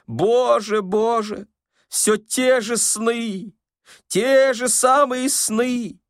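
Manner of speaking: put-on high, drawn-out old man's wail; wailing complaint. A narrator's reading. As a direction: lament loudly, stretching the words high. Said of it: "Произнося эту жалобу, старик заплакал".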